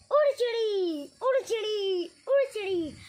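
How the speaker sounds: loud calling to scare birds off; farmer shooing birds. Acted. Farmer shouting at birds in his maize field, loud and calling. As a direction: call out to shoo birds from the corn.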